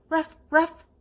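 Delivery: high, loud yappy puppy ruff; playful puppy bark. Acted. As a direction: bark like an eager little puppy. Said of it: "bark like one".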